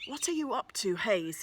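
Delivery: loud, stern demand. A narrator's reading. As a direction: bark it at the boy like a head teacher. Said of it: "he demands".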